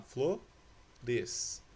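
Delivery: "flores" very slowly, as flo, res; split into syllables. Shown as a demonstration. Teacher showing pupils which letters stay together, "flores" syllable by syllable. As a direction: clear pause between the syllables of "flores". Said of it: "juntas na mesma sílaba".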